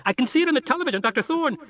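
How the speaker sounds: put-on high, frantic kid's voice over the radio; a panicked boy shouting a warning; hysterical boy. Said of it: "He sounds completely hysterical".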